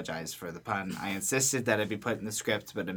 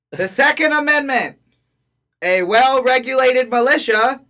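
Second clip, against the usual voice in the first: loud, high town crier proclamation; 11 semitones higher, 10 dB louder than usual. Pretend. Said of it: "the same tone as it would have been announced by a town crier".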